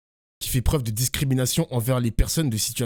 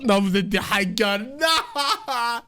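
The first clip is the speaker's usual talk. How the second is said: laughing; 7 semitones higher, much slower than usual. Real, not acted. Streamer laughing at chat.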